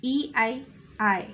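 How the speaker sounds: normal talking voice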